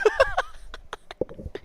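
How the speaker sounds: soft, high wheeze of laughter; real laughing fit. Real, not acted. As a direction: dissolve into near-silent giggles. Cracks up trying to resume.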